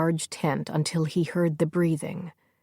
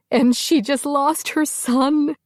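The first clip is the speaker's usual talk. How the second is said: loud, high tearful cry; the grief breaks out at full pitch; panicked wail; 10 semitones higher, 6 dB louder than usual. Acted. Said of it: "make her louder and more panicked".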